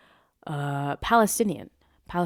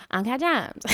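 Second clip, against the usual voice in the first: lightly mocked drawn-vowel catchphrase; hint of a celebrity's odd pronunciation; playful mimicry of a viral catchphrase; Julia Fox impression; 6 semitones higher, 3 dB louder than usual. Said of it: "shout out to Julia Fox".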